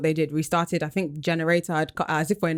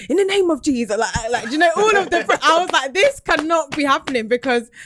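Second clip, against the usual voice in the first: high and loud panicked exclamation; frantic panic; 9 semitones higher, 5 dB louder than usual. Retold or imitated. Teller recalling the interview collapsing while mum prays, high frantic panic. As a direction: plead in rising alarm.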